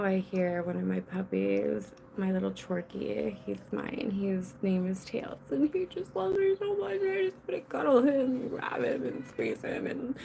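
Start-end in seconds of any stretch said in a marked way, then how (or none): 5.5-10.2 high, squeezed cutesy gushing; doting gush. Real, not acted. Owner adoring her little puppy Tails, doting gush.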